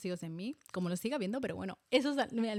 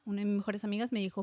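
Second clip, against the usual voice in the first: ordinary talk, nothing marked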